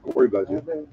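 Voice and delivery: high, hushed mock concern; mock tender. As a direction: coo it like a doting parent. Checks on sleeping listener.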